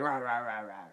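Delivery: mock Rottweiler barks; spoken "rah rah" dog imitation; imitated dog barking. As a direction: bark like a dog, half-playfully. Imitates Pennywise with Rottweiler head.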